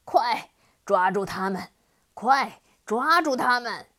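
very loud enraged demon command; loud raging demon yell; furious shout. Acted. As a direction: roar the order to catch them. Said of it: "愤怒地跺着脚大叫".

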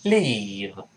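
"leave" with a long ee and soft v; long vowel demo. Shown as a demonstration. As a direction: stretch the vowel of "leave", teeth on lip for v. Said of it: "长音发一这个声音".

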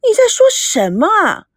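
sharp, rising protest at her father; angry outburst. A narrator's reading. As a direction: push back at him, stung and indignant. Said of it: "孙娜怒从心生".

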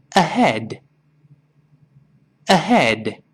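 unhurried "ahead", final d released; slow careful word. Shown as a demonstration. Teacher has students read along first, slow careful word. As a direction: model the single word slowly. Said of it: "跟老师先慢慢读这个词".

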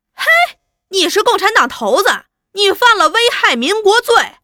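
high, loud accusing shout; angry accusation. Acted. Interrogator accuses prisoner of being Party chief, high angry shout. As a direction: hurl the charge at full voice.

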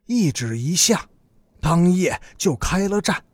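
ordinary delivery, nothing marked

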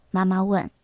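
usual speaking voice

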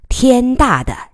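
天大的 pressed with heavy emphasis; stress on 天大的. A narrator's reading. Officer hinting at the reporter's text message, stressing 天大的. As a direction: lean hard on the words 天大的. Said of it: "三个字加了重音".

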